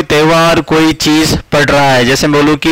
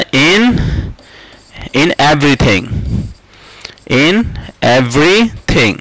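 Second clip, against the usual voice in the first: slow model phrase; much slower than usual. Shown as a demonstration. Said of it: "ऐसे करके".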